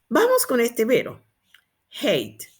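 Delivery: normal talking voice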